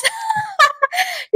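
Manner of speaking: very high squealy giggle; squealing giggle. Real, not acted. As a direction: giggle like a starstruck fan. Giggling after confession.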